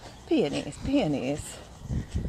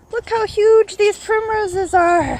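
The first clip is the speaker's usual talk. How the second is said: high, loud burst of admiration; excited marvelling; 11 semitones higher, 6 dB louder than usual. Real, not acted. Gardener showing off huge primroses to a viewer, excited marvelling. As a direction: exclaim in amazement, pitched well up.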